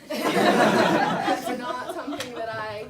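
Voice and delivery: loud, slow words through a laugh. Real, not acted. Laughs at her own moral.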